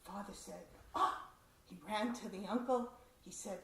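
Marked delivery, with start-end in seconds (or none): 1.0-1.3 shocked "Ah!". Retold or imitated. Father's shocked exclamation.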